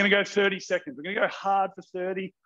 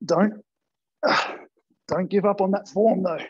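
ordinary talk, nothing marked

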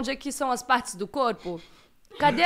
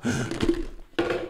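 mock gasp.

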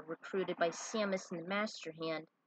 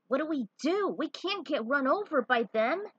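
alarmed; 7 semitones higher, 6 dB louder than usual. Acted. Cars closing in.

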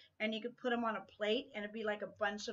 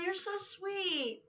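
touched and tender; 9 semitones higher, much slower than usual. Real, not acted. Touched by friend's card.

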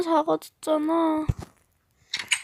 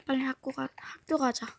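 hurried whisper; 3 semitones lower, 7 dB quieter, faster than usual. Acted. 몰래 속삭임.

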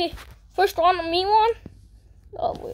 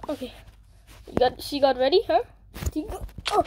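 usual speaking voice

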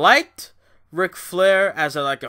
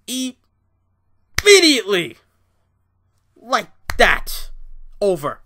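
sudden shout; 4 semitones higher, 6 dB louder, slower than usual. Real, not acted. Angry threat to commenters.

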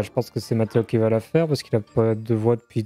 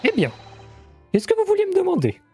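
higher NPC voice; 9 semitones higher, faster than usual. Acted.

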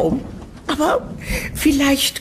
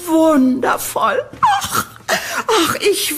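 delighted; 5 semitones higher, slower than usual. Acted. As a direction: squeal with joy at the name. Landlady delighted by tenant's name. (hohes, entzücktes Aufjauchzen)

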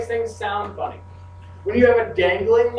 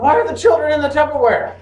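mock alarm; 5 semitones higher, 4 dB louder than usual. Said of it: "mock alarm".

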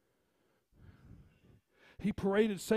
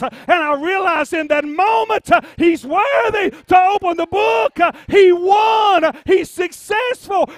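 preacher climbing into slow, shouted triumph; full-force preaching; 7 semitones higher, 18 dB louder, slower than usual. Real, not acted. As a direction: build from emphatic to high, roaring shout.

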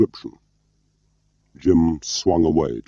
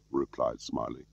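ordinary delivery

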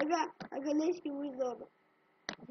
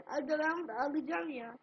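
usual speaking voice

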